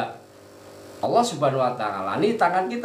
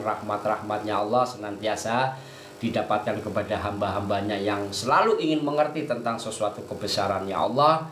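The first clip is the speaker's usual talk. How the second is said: ordinary delivery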